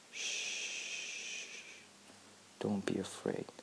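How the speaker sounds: soft, gentle lullaby hush; calm a frightened baby softly; soothing hush. Acted. She comforts her crying infant self, hushed and tender.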